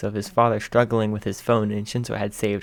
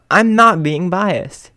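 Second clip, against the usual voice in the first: much higher, slow mock protest; playful protest; 9 semitones higher, 8 dB louder, much slower than usual. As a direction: deny it theatrically. Host protesting.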